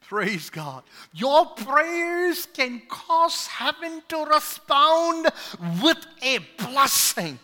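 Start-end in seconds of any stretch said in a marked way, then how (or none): 1.1-7.4 raised, slow preacher's emphasis; preaching. Acted. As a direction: lift the line to the congregation. Preacher lifting the point.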